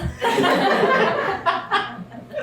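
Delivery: shrieking laugh falling away; very high laugh. Real, not acted. Son still laughing at the diners' reaction, shrieking laugh. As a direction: let the laugh soar then drop. Laughing at his own impression.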